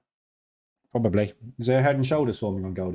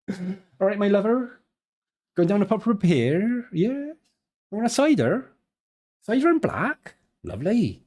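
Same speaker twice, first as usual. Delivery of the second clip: high-pitched mock West Country local; exaggerated West Country accent, pitched well up; 8 semitones higher than usual. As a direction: ham up a chummy West Country pub-goer. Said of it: "You get exactly the same voice".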